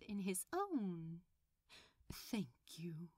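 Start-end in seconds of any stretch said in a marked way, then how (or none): 2.1-3.1 soft breathy thank-you; a hushed sincere thank you; sincere whisper. Acted. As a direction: whisper it with heartfelt gratitude. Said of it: "he said sincerely".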